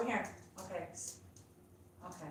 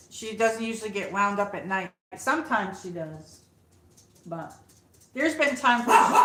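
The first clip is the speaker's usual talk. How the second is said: ordinary talk, nothing marked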